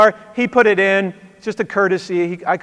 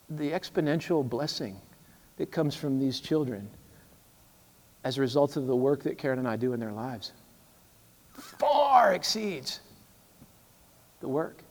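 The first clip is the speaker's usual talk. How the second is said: earnest soft reply; 7 semitones lower, 12 dB quieter than usual. Retold or imitated. Pastor's earnest answer retold.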